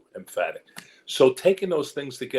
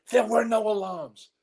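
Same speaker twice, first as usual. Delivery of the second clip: very high, drawn-out exclamation; high emphatic cry; 13 semitones higher, 4 dB louder than usual. Retold or imitated. Actor telling the director there was no security, high emphatic cry. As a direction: stretch it out high and insistent. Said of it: "I said it just like that".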